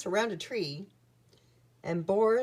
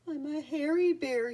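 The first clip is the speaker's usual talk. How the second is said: understated sob, higher-pitched storybook voice; a sad bear's mild, mock sob; sobbing; 8 semitones higher than usual. A narrator's reading. Lonely bear Boris to himself, mildly sobbing. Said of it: "he sobbed".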